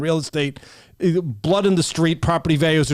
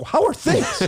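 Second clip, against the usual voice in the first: incredulous laughing echo; slower than usual. Retold or imitated. Echoing the senator's question back in laughing disbelief. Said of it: "I go".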